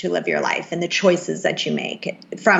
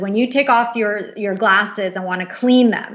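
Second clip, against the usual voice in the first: ordinary talk, nothing marked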